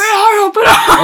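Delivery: high, loud cop yell through laughter; mock police shout. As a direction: bark it like a raiding agent. Cops bursting in.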